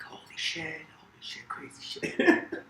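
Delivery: awed soldiers whispering; stunned whisper from the back of the army. Retold or imitated. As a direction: hush it to the soldier beside you. Soldiers react in the back ranks.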